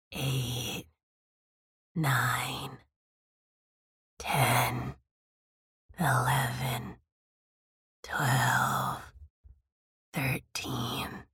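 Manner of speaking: low, soft wheezing whisper; wheezing count. A narrator's reading. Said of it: "listen to her count the broom bristles".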